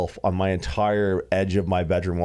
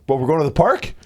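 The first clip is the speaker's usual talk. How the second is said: higher, hopeful dog asking a question; 7 semitones higher, 5 dB louder, faster than usual. Retold or imitated. Dog waking its owner, hopeful about the park. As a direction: voice the dog, eager and curious. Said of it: "The dog was like".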